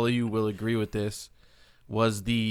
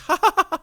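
imitated Sasha laugh; 6 dB louder than usual. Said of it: "Oh, the laugh, like".

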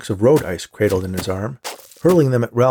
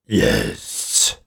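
much lower, gravelly snowman rasp; deep gravelly voice; 14 semitones lower than usual. Acted. Snowman answers the awed boy, in a deep gravelly rasp. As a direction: let it rumble up from deep inside, gravelly. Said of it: "Abe replied in a deep, gravelly voice".

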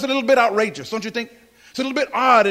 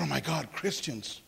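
slow, much softer stunned murmur; stunned aside; 7 semitones lower, 13 dB quieter than usual. Retold or imitated. Newly saved man seeing the pastor hug his wife, stunned murmur. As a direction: let it fall out of you in disbelief. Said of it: "I said".

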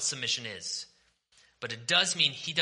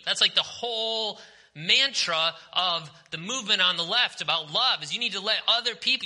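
higher and louder, driving; heated preaching; 7 semitones higher, 4 dB louder than usual. Real, not acted. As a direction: push the point hard from the pulpit. Preaching against a slogan.

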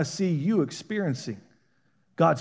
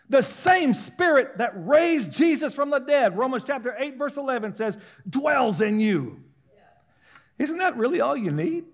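high, ringing preacher's voice building to a cry; fervent preaching; 7 semitones higher, 4 dB louder than usual. Acted. Preacher urging his church not to give up, fervent. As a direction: preach it to the congregation with full force.

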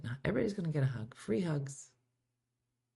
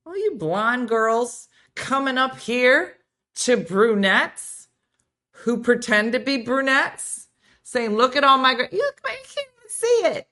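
mock exasperation; 7 semitones higher, 7 dB louder than usual. Real, not acted. Host loudly mocking blonde friends who complain about grays. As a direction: roll your eyes at the blondes.

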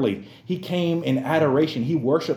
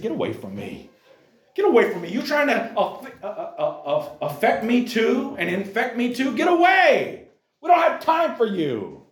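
mock angry rejection; 8 semitones higher than usual. Townspeople shoving off a leper, hammed-up angry shout. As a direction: shoo someone off in disgust. People driving leper away.

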